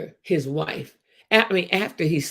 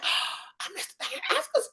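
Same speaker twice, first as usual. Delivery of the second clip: much higher, soft gasp of dismay; retold dismay; 7 dB quieter than usual. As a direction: relive the moment you realise you missed it.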